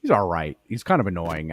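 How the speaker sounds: normal talking voice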